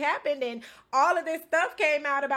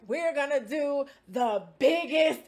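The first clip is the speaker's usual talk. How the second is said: dramatic announcement; slower than usual. Retold or imitated. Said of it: "he's doing all this extra shit".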